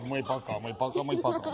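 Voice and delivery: low, soft tut-tutting; mock disappointment. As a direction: shake your head at it, quietly. Mocking tiny score. (decepción burlona)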